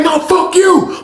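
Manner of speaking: high, drawn-out yell; hammed-up cursing match; acted heated comeback. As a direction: top his insult, slow and high. Acted trading of insults.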